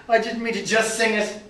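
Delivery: panicked protest. Acted. Brian panicking before the king.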